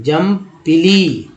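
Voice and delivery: ordinary delivery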